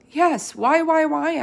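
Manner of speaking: high, loud squeak of alarm; frantic little squeaking cry; squeaky pleading. A narrator's reading. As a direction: squeak it out like a panicked hamster. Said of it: "I squeaked".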